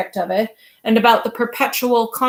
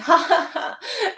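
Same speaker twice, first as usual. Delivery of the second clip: high, forced laugh of mock amusement; shrill false laugh; 8 semitones higher than usual. A narrator's reading. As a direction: laugh like an angry man pretending to be amused. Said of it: "Again the shrill and false laugh".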